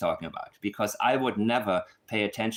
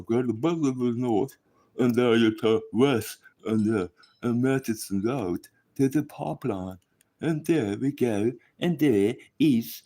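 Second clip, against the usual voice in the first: mocking Ninchi. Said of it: "I cannot do the accent".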